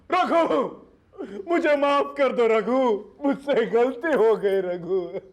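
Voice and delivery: slow, high wailing mock plea; imitated begging. Man acting out the wrestler's apology, hammed-up begging. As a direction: wail for mercy, sinking toward the end. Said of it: "उसने मेरे arms देखकर बोला".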